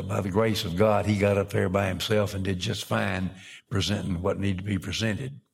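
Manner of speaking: ordinary delivery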